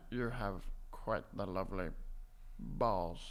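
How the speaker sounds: Alan Rickman. Acted. Imagined actor whispering in a listener's ear, low and hushed. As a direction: murmur it deep, with pauses. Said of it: "Alan Rickman s-, whispering sweet nothings".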